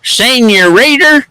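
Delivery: Senorita drawn out high in an East Tennessee twang; Spanish word in East Tennessee dialect. Shown as a demonstration. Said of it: "putting my East Tennessee dialect on it".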